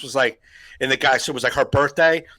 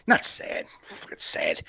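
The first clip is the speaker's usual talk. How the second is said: much softer, lower aside; muttered backtrack; 10 semitones lower, 8 dB quieter than usual. Real, not acted. Co-host retracting his sad confession, muttered. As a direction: mutter it away under your breath.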